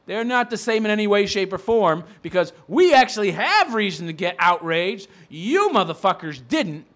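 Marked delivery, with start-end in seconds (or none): none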